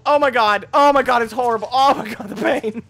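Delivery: loud, high, hammed-up horror; mock horrified shouting. Gamer watching a gory crash, mock horror. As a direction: shout it in exaggerated agony.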